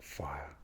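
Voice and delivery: "fire" without the R; posh English pronunciation. Shown as a demonstration. Demonstrating posh English, "fire" with no R. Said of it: "without the R for the posh English".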